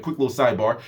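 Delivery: ordinary talk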